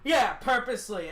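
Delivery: ordinary talk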